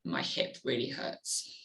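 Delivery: soft, slow near-whisper, as if to yourself; a hushed private thought of hurting; inner trigger thought. Retold or imitated. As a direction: murmur the first flash of pain to yourself. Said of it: "first thought I get in my mind where I go".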